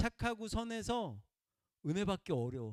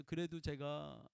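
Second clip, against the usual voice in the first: much softer, tender admission; gentle smiling confession; 3 semitones lower, 9 dB quieter than usual. Retold or imitated. The hurt woman telling of her love for God, soft and tender. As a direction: say it softly with a small smile. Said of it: "웃으면서 또 얘기해서".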